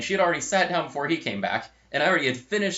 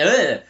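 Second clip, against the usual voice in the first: loud, higher imitated "ugh"; disgusted noise; 7 semitones higher, 10 dB louder than usual. Friend tasting milky green tea, imitated disgusted groan. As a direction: groan loudly in mock revulsion.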